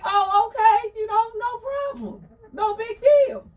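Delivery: high, loud breezy hen voice; Crystal playing it cool. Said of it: "Crystal was like".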